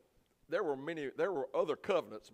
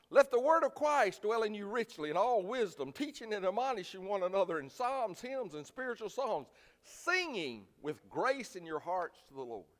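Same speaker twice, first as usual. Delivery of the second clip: scripture reading; 7 semitones higher, 5 dB louder than usual. Acted. Pastor reading Ephesians to the church: raised solemn scripture reading. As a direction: read it out as holy writ.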